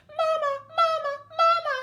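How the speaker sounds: put-on very high, loud child's cry; the baby dragon calling to its mother. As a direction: cry out for mama. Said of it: "he called".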